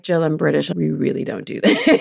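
lightly voiced low British refusal; reserved British colleague. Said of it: "he, he, British".

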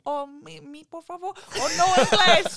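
high, loud, slow mock-Spanish voice; fake Spanish accent. Dodging pamphlet people with fake Spanish accent. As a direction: ham up a Spanish accent, playing dumb. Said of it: "Let's pretend we're Spanish".

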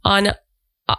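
steady, projected announcement read; promo read. Acted. Host inviting listeners to a self-care retreat, promo read.

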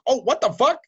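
high, loud retold astonishment; mock shock, pitch jumping up; surprised realization. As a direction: act out sudden recognition. Retold surprise at recognizing caller.

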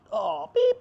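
high startled cuss; mock shock. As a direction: squeal it like a scandalized listener. Congregation cussing in shock.

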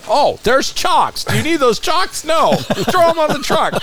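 playfully hammed-up voice, grabbing everything in sight; mock eager stealing.

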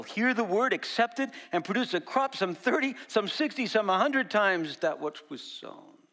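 building climax. Acted. Climax of the parable reading.

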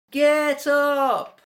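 high, sustained yell; yelling a name. A narrator's reading. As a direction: holler down into the tunnel. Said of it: "they yelled".

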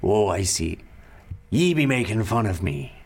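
put-on mildly gruff pirate accent; a touch of a pirate's grumble; pirate captain, mock-offended at being mimicked, pirate accent. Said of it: "And he's like".

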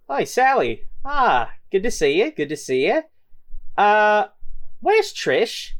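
high-pitched swaggering villain greeting; gang leader. Acted. Game master voices gang leader.

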